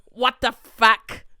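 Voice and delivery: loud, slow bewildered what the fuck; old man's bewildered line. Retold or imitated. Retelling the movie's old man, loud slow bewilderment. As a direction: drag it out, baffled and loud. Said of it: "And he says it like that".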